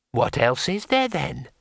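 a small painted imp, unhurried; tiny imp. Acted. Imp settling the matter for the wizard, small creature voice.

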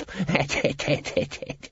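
high staccato cackle; wheezy tittering laugh; villainous snicker. Acted. Guild boss pleased at his scheme, villainous snicker.

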